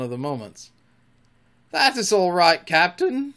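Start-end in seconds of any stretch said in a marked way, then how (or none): 1.7-3.3 high, loud, self-satisfied reassurance; complacent. A narrator's reading. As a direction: answer pleased with yourself. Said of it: "Smee answered complacently".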